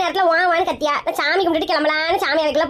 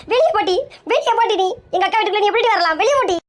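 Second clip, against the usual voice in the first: higher-pitched furious order to get out; angry yelling; 4 semitones higher than usual. Acted. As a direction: drive her out of the house, shouting. Sister chases rival out of house. (கோபமாக கத்தி விரட்டுதல்)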